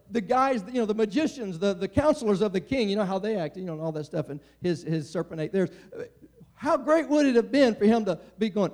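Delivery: ordinary delivery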